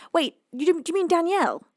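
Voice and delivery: ordinary talk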